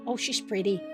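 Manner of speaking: ordinary talk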